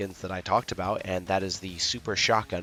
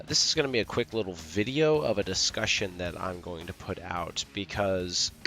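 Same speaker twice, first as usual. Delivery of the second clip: normal talking voice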